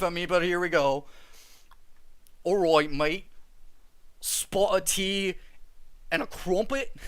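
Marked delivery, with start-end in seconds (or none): 2.4-6.9 hammed-up British accent, drawn out. Shown as a demonstration. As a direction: put on a cheesy British accent. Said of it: "asking for a British accent".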